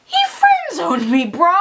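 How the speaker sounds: high, drawn-out indignant shout; slow, high-pitched exasperated yell; exasperated outcry. Real, not acted. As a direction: cry out in disbelief at being wronged. Ranting about being friend zoned.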